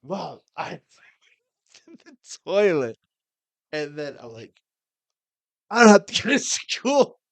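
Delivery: laughing through quote. Real, not acted. Kid retelling gleeful sick day.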